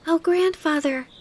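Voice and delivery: put-on high, loud child's greeting; high-pitched excited little girl; crying out. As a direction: call to grandfather, bursting with news. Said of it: "she cried".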